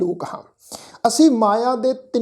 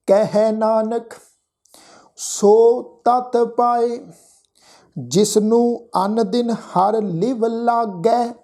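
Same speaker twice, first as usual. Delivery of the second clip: slow, measured Gurbani recitation with drawn-out vowels; scripture recitation; much slower than usual. Shown as a demonstration. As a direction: recite the sacred line word by word, stretching the endings. Recites the verse for students. (ਹੌਲੀ, ਲਮਕਾ ਕੇ ਗੁਰਬਾਣੀ ਪਾਠ)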